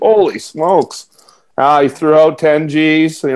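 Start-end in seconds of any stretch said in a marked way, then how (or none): 0.0-1.1 amazed. Retold or imitated. Retold reaction to big offer.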